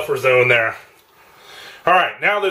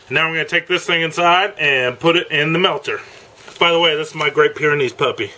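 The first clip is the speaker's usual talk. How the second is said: ordinary delivery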